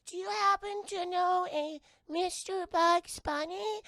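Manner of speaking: high, slow, prim alien voice; polite alien voice. Retold or imitated. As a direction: ask it like an overly polite little alien. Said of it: "they ask".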